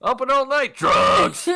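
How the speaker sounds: loud, high shouted jingle; mock drug advert. Game master hawks drugs as a joke, shouting. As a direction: holler it like a sales pitch.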